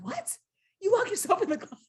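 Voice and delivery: very high, fast incredulous squeak; shocked disbelief. Retold or imitated. Disbelieving reaction to closet story.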